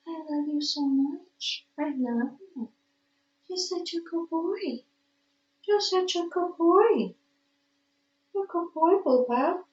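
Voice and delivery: soft, high cooing to a pet. Real, not acted. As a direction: coo lovingly to the cat, mostly soft. Doting on the cat.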